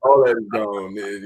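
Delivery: usual speaking voice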